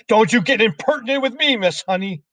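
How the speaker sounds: high, loud Trunchbull rebuke; indignant headmistress snapping at a teacher; angry snap. A narrator's reading. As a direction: snap sharply in a high, bullying voice. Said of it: "the Trunchbull snapped".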